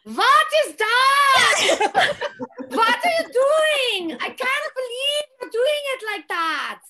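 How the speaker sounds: high, loud, theatrical coach voice; impression of the late coach. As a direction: channel her bossy coach voice from the boards. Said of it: "I do a good impression of your mom".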